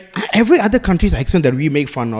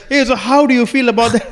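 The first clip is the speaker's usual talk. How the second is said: mock interviewer voice; 7 semitones higher than usual. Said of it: "After some sports out of breath".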